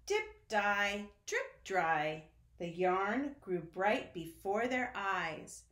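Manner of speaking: ordinary delivery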